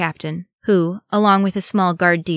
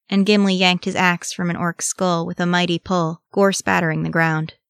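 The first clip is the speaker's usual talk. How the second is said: ordinary delivery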